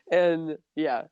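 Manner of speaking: normal talking voice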